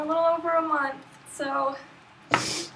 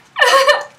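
high tearful laugh; 12 semitones higher, 13 dB louder than usual. Real, not acted. Overjoyed author sees book.

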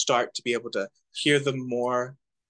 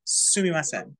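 Sumimasen led by a stressed, higher "Su"; emphasis on su-; 4 semitones higher than usual. Shown as a demonstration. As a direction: stress the first syllable of Sumimasen and fall away. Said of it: "With the emphasis on su".

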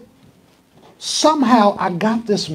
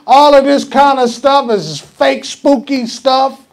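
retold scorn, slow and drawn out, then higher and louder; scornful dismissal; 6 semitones higher, 6 dB louder than usual. Preacher recalling his first judgment of TV evangelist, loud mock scorn. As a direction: denounce him like a con man exposed. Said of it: "you know what I thought about him".

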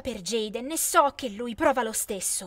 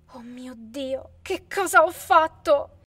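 dismay; 5 dB louder, slower than usual. A narrator's reading. Lara realizes her fault.

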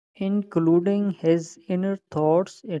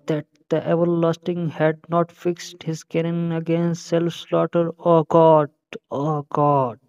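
slow, emphatic soliloquy delivery; measured recitation swelling into a loud exclamation; reciting Hamlet; 4 dB louder, much slower than usual. Acted. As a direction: declaim it slowly, building to a loud cry to God. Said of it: "तो शुरू करें फिर Hamlet की first soliloquy".

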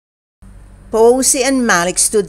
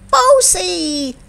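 high, stretched-out storybook winner call; announcing shout; 10 semitones higher than usual. A narrator's reading. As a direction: call out the winner's name, pitched way up. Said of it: "shouted Esme".

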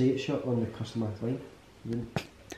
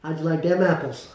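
loud, higher mock Boston accent; 5 semitones higher, 8 dB louder than usual. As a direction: throw out a brash Boston movie quote. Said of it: "the Boston, Massachusetts".

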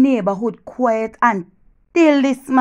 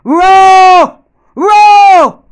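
loud, high lion roar, building; lion's roar; 9 semitones higher, 10 dB louder, much slower than usual. Acted. Woman showing Jenny her lion spirit, roaring like a lion. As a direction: roar louder and higher each time. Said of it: "I go".

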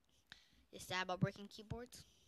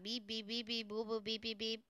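robot beeps. Retold or imitated. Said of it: "That's what you sound like right now".

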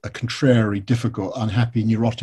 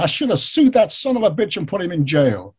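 higher-pitched mock outrage; imitated angry rant; 6 semitones higher than usual. Retelling the villain's phone tirade, angry rant. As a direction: act out an indignant man's grievance. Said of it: "He goes off on this rant".

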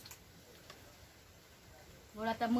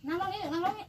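higher, louder firm call to sit; 9 semitones higher, 5 dB louder than usual. Real, not acted. Mother telling the toddler to sit down here, high firm call. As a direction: tell the little one to sit down, raised voice.

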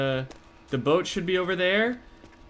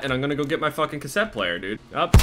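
ordinary delivery

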